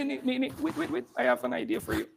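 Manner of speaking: usual speaking voice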